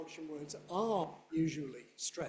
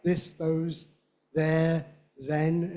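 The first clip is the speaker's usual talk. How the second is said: "this, those, there, then" each loud; stressed demonstratives; 9 dB louder, much slower than usual. Shown as a demonstration. Teacher listing demonstratives, stressed one by one. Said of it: "very often stressed".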